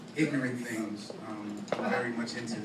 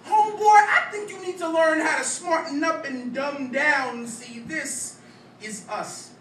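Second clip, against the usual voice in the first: higher, louder, rhythmic slam-poem delivery; spoken-word poetry; 13 semitones higher, 5 dB louder than usual. Acted. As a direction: perform it as a punchy stage poem.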